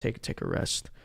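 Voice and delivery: hushed, low voice dropping toward a whisper; resting a tired voice, half whispered; talk-like-this soft voice. Shown as a demonstration. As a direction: ease off into soft, voice-saving speech. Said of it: "talk like this".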